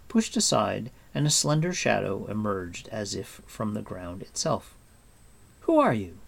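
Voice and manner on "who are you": loud startled shout. A narrator's reading. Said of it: "Taryn shouted".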